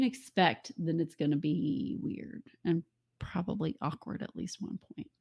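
slow, hushed whispered aside. Real, not acted. Whispering a wry aside to listeners at the close. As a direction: whisper the sign-off joke. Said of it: "whispered aside".

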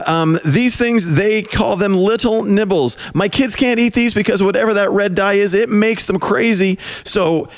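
high bright commercial voice; ad read. Acted. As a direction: pitch it up like an ad. Fake sponsor ad read.

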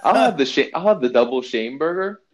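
loud mock counter order for a shame burger. Joking to a friend, a loud mock burger order. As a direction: place the order like a big joke.

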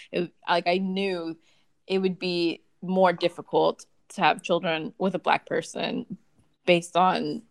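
ordinary delivery

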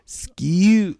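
"Skew" for school, pitched high; mimicked child's "Skew", vowel fronted; British-girl "school". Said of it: "It's British".

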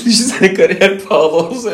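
laughing while talking. Real, not acted. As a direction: talk through a burst of laughter. Laughs at own joke.

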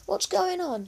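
high-pitched sharp question; high, insistent motherly demand. A narrator's reading. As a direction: demand an explanation as Mum. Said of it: "she demanded".

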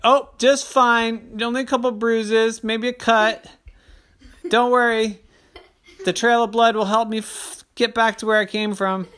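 high, loud deadpan mom. Acted. Mom brushes off her bruises, high loud deadpan. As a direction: joke through your injuries. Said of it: "mom's wry reply".

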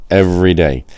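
normal talking voice

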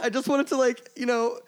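high-pitched imitated tearful voice; mock bawling. Retelling a tearful explanation to Josh, mock bawling. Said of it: "bawled my fucking eyes out".

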